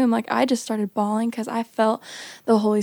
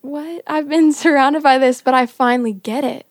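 higher, louder, laughing disbelief; excited realization; 3 semitones higher, 7 dB louder than usual. Retold or imitated. Girl realizing her parents' ministry finally makes sense, excited. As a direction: burst out with the discovery.

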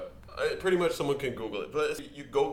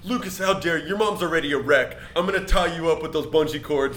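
imitating a loud, angry yell; mock a furious boyfriend from Jersey; 5 semitones higher, 7 dB louder than usual. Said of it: "he started yelling at me".

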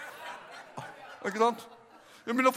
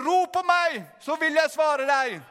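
high, ringing quotation; proclaimed scripture; 5 semitones higher, slower than usual. Real, not acted. Preacher quotes God's promise to the congregation, raised proclaiming voice. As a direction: declare the promise with fervour.

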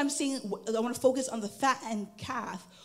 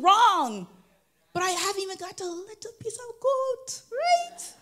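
put-on jealous older brother; 6 semitones higher, 4 dB louder than usual. Older brother's jealous complaint acted out.

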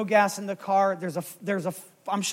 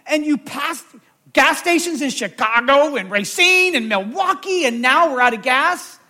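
exasperated, raised-pitch rant at a spouse; retold angry outburst, building louder; mad; 4 semitones higher, 4 dB louder than usual. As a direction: let fly in mock outrage. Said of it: "I'm mad".

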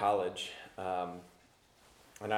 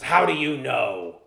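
slow gravelly challenge; 3 semitones higher, 8 dB louder, slower than usual. Retold or imitated. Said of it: "And he goes".

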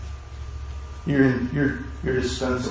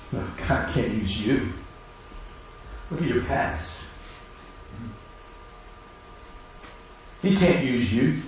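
Satan's whisper; 9 dB quieter than usual. Acted. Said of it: "Satan wants us to doubt".

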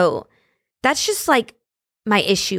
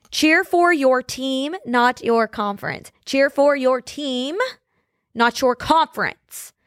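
slow emphatic scolding; 3 semitones higher, slower than usual. Real, not acted. Scolding fans, repeated slogan.

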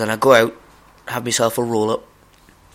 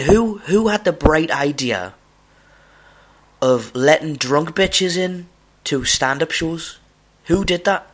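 exasperated rant; 6 semitones higher, 3 dB louder, slower than usual. Real, not acted. Ranting about drunk audiences.

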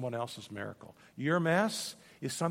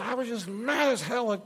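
retold anger; 8 semitones higher, 4 dB louder than usual. Repeats woman's angry words after aside.

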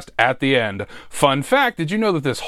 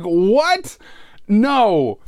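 higher-pitched mock shock, then refusal; retold disbelief; 3 semitones higher than usual. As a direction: replay a baffled question and refusal. Acts out own startled refusal.

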